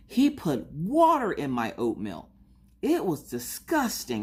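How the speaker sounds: hammed-up outraged kid imitation; slow, high-pitched pouty horror, as if utterly wronged; Maggie. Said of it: "the way she was acting".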